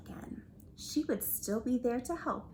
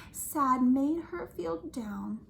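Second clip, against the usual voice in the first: usual speaking voice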